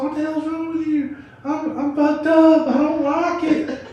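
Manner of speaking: put-on high, slow wail of a crying girlfriend; high mock sobbing. Girlfriend crying after the edible, high drawn-out wail. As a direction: whimper it like she's overwhelmed.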